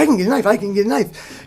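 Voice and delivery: high, fast mock panic; imitated freak-out. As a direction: rattle it off like someone freaking out. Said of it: "he's, like, freaking out".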